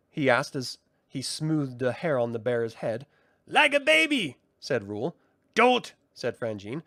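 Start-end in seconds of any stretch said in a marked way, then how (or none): 3.5-4.4 high, theatrical sprite voice; mocking brownie. Brownie Rule quips. 5.5-5.9 high, loud, snippy sprite; contemptuous brownie jab; scornful brownie. Acted. Frangine insults Rule.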